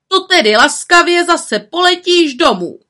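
stern command. A narrator's reading. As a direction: order her home sharply. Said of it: "přikázala vrchní čarodějnice".

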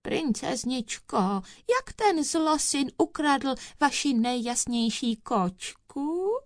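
sugary, thin high voice; thinnest little voice. A narrator's reading. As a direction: coo to the princess in falsetto. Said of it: "nejtenčím hláskem".

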